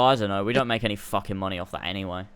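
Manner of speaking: ordinary delivery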